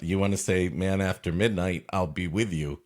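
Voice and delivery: normal talking voice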